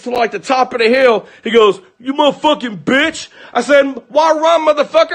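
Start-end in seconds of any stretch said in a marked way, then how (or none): 2.0-3.3 high-pitched retold yell; imitated distant taunt. Boyfriend yelling from atop the hill, imitated taunt. 4.1-5.1 high, heated holler; retold shouted challenge. Husband shouting after the fleeing car, retold challenge.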